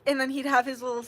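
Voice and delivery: ordinary talk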